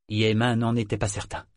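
plain reading voice

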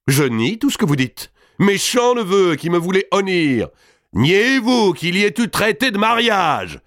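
high, loud contemptuous denial; angry retort. A narrator's reading. Mao denies everything to her nephew, high and angry. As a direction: spit back at the nephew.

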